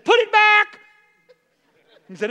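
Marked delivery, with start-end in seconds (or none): 0.0-0.8 loud, high command; commanding shout. Retold or imitated. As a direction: order it back with full force. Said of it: "And he said".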